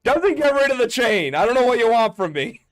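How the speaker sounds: loud, high-pitched protest; exasperated shouting. Real, not acted. Game master argues over a nat twenty and the chain, exasperated shouting. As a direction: shout back in comic exasperation.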